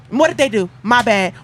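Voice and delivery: normal talking voice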